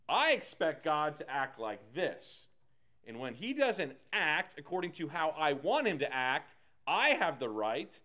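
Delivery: normal talking voice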